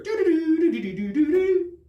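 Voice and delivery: high, loud sing-song phone ring; mock ringtone in doo-doo syllables; imitated ringtone. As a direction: mimic a cell phone ringing out, high and drawn out. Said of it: "whatever cell phones make".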